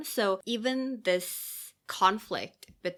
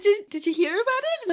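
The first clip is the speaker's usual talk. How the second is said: much higher, hopeful little voice; eager Ella; 8 dB louder than usual. Retold or imitated. Host voicing Ella asking ogres about her protest, high and hopeful. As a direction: sell it like she expects praise.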